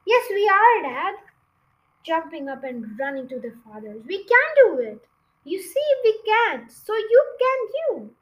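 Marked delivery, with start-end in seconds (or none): 0.1-1.2 put-on high, loud insistent child; eager small fox. Small foxes insisting to Dad, high and loud. 4.1-8.2 put-on high, loud insistent child; eager small fox. Small foxes insisting to Dad, high and loud.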